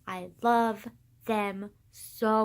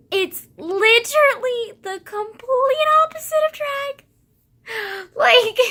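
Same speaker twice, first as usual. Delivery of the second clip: much higher and louder insistence; emphatic exclamation; 10 dB louder than usual. Real, not acted. Teen insisting vintage is drag's opposite, loud high exclamation. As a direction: throw the words up high and loud. Stressing total opposite of drag.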